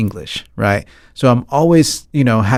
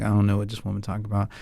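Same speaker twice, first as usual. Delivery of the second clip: quick, low aside, much softer; mock clueless kid, hushed and hurried; imitated baffled child; 3 semitones lower, 11 dB quieter, much faster than usual. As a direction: toss it off fast under your voice. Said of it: "they're just looking at you like".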